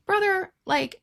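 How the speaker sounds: high, loud exasperated call; calling out a fictional man, exasperated; exasperated brother. Retold or imitated. Reader scolding the hero, exasperated high call.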